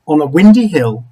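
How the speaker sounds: ordinary delivery, nothing marked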